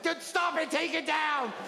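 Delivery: high-pitched, urgent begging; hammed-up desperate demand, pitched high; distressed plea. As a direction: call out in mock horror. Acted plea to screen operators.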